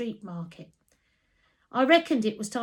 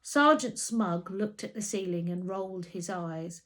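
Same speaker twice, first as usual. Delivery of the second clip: usual speaking voice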